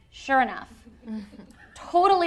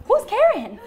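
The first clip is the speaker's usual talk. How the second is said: high, loud, laughing question; puzzled midwife; 13 semitones higher, 8 dB louder than usual. Retold or imitated. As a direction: ask it baffled. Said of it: "the midwife was like".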